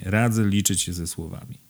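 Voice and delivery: ordinary delivery, nothing marked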